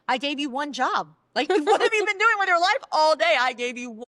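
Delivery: acting out a fed-up wife's rant; exasperated scolding. Wife scolding husband over his one job, exasperated.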